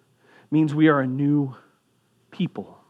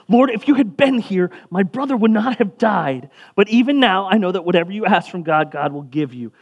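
pleading outburst, high and loud, settling at the end; distraught Martha; 6 semitones higher, 5 dB louder than usual. Acted. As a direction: reproach him in raised-voice grief. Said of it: "the distraughtness".

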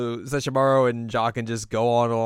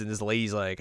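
ordinary talk, nothing marked

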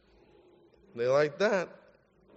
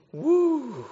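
high, drawn-out "woo"; awed whoop; 9 semitones higher than usual. Real, not acted. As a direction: whoop as if she's a real handful. Reacting to feisty youngest daughter.

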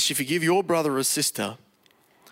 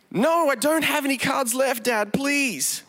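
higher, desperate begging; pleading son; 7 semitones higher than usual. A narrator's reading. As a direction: beg Dad like a frightened child.

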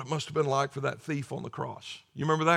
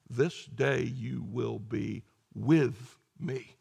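gentle promise; 5 semitones lower, 4 dB quieter, much slower than usual. Acted. Jesus answers dying thief.

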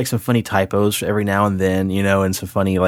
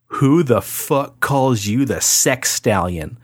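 slow, incredulous mock outrage, higher; retold outraged demand; imitating angry wife; 4 semitones higher, much slower than usual. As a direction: draw out each word like an indignant wife. Retells wife's furious phone call.

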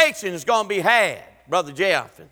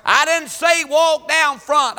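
ordinary delivery

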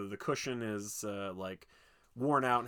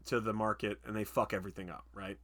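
usual speaking voice

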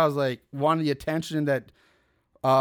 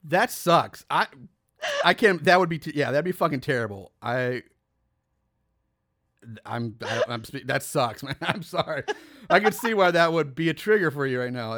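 gently sorry for her; commiserating; 3 semitones lower than usual. Real, not acted. Friend shares a betrayal story.

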